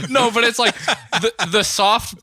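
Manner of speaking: giggling through the words; laughing. Real, not acted. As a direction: laugh while trying to talk.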